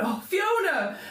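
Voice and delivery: high, swooping exclamation of relief; overjoyed cry. Acted. Councilwoman seeing Fiona back at the marketplace, overjoyed. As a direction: cry out the name on seeing her. Councilwoman spots her returned daughter.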